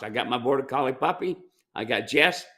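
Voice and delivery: normal talking voice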